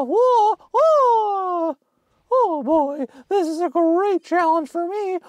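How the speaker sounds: very high, whooping squeals and words; high falsetto whooping. Pretend. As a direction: keep it all up in a hammed-up falsetto. Hammed-up reaction to game challenge.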